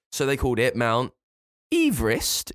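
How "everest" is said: Everest as EEV-rist, pitched high; original pronunciation. Shown as a demonstration. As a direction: lift and stretch the EE in Everest. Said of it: "He pronounced his name Everest".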